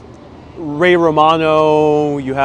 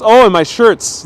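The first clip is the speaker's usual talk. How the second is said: loud, raised "oh, I get it"; the penny drops, higher and louder; sudden realization; 6 semitones higher, 6 dB louder than usual. Real, not acted. Realizes the comment said shirts.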